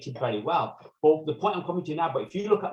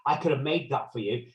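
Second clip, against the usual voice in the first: usual speaking voice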